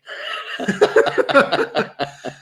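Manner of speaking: long, high breathy laugh. Real, not acted. Host hears co-host's lookalike story, high laughing. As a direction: burst out laughing at the joke.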